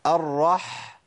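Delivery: "الرح" ending on a long rasped h; audible husky ha. Shown as a demonstration. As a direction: let the h in "الرح" ring out. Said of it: "سمع صوت الحاء؟".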